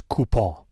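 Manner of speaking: COUPON as "koo-POH", French ending without a hard N; Frenchify COUPON playfully; mock French pronunciation. Host jokes about the correct answer, COUPON in mock French. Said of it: "You're turning everything French today".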